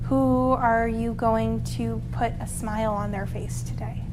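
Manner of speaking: voiceless whisper; whispered meditation question. Acted. Soft guided meditation.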